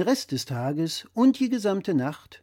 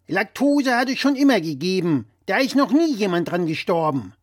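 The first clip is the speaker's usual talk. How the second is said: louder, higher know-it-all dad voice; blustering father; 4 semitones higher, 6 dB louder than usual. Acted. Father lecturing his son over the phone, louder and higher. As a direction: play the pompous father correcting his son. Father dismisses lactose intolerance.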